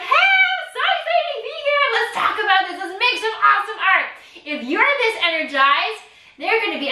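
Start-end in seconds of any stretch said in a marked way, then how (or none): 0.0-4.1 high, bubbly, over-the-top enthusiasm; beaming high-pitched welcome; excited teacher greeting. Shown as a demonstration. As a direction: sell it like you're thrilled to be here. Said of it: "you're like".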